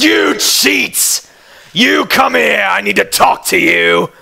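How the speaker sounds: high, drawn-out angry call; angry cry. Acted. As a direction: cry out accusingly across a plaza. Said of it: "cries out".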